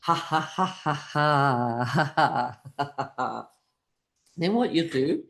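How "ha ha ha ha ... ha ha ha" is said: string of measured ha-has tailing off; deliberate laughing sound. Shown as a demonstration. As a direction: laugh out the whole breath on purpose. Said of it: "Once more, breathing in".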